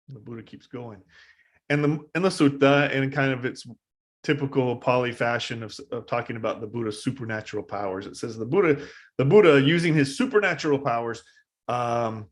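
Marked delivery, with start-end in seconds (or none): none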